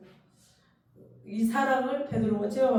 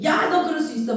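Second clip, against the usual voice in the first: mock angry scold; 7 semitones higher, 5 dB louder than usual. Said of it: "악마가 돼가지고".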